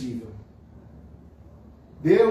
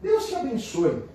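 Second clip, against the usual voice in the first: bright, higher kindly blessing; a happy, lifted blessing; 8 semitones higher than usual. Shown as a demonstration. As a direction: bless them warmly. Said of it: "a diferença até da, da entonação da voz da gente".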